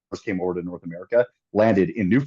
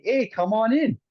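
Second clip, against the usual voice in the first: high, breezy welcome; friendly border guard; 12 semitones higher, slower than usual. Retold or imitated. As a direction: wave them through cheerfully. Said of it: "We're like".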